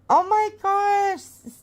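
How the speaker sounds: drawn-out, high, stunned oh my gosh; surprised. Real, not acted. Reacts to gifted memberships.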